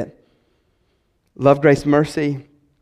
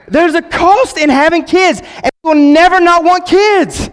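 preaching at full force; 7 semitones higher than usual. Real, not acted. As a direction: preach it to the whole church, full tilt. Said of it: "I'm preaching now".

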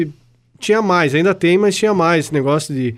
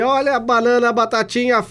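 higher sing-song vendor call; rhyming market barker; 6 semitones higher than usual. Retold or imitated. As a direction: hawk fruit like a street seller. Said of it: "daí diz assim". (pregão de vendedor de frutas, mais agudo)

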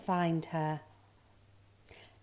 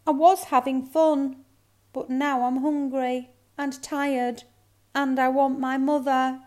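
high, loud, monotone complaint; gloomy cub; 6 semitones higher, 6 dB louder than usual.

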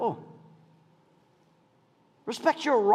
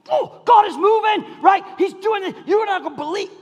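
high, loud, exuberant gush; excited outburst; 12 semitones higher, 6 dB louder than usual. Acted. Husband gushing to his wife about the meeting, excited outburst. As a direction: burst out with acted excitement. Acted happy reply to wife.

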